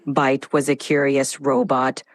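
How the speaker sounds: low, metallic cyborg voice; robotic voice. Acted. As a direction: speak like a droning robot. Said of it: "can you do this in a robotic voice".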